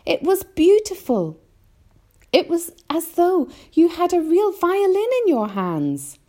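put-on high-pitched enthusiastic small boy; James. A narrator's reading. James praises the grasshopper's playing, high and thrilled. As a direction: marvel in a high child's voice. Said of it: "James answered".

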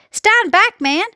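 high, urgent order; police chief calling out in alarm; yelled warning. A narrator's reading. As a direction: warn him back before it jumps. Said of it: "yelled the chief of police".

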